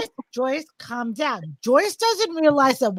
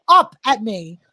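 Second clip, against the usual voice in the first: ordinary talk, nothing marked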